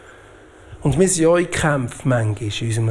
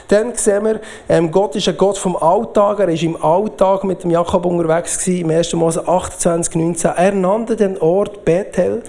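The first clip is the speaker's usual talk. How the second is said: normal talking voice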